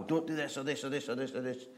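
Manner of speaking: fast mock list of don'ts; mock killjoy God rattling off rules; rattled-off rules. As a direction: rattle off forbidding rules quickly.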